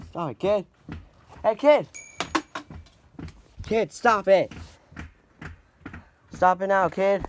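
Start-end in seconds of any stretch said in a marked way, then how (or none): none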